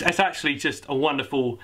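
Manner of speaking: normal talking voice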